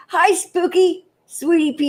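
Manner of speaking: high cutesy little voice; pet's voice. Retold or imitated. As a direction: speak for the pet sweetly. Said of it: "Spooky says".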